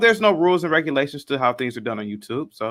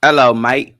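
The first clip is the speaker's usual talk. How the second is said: mock British "Hello, mate"; fake British; 2 semitones lower, 8 dB louder, slower than usual. American guest trying a British accent. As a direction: try a British greeting as a joke. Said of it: "was that British".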